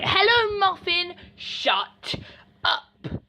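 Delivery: high mocking hello, dropping to a flat shut up; taunting classmate voice. Imitating the boy who insults her, high sneering mock voice. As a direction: mimic the bully's sneering voice. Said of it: "He says it in that voice".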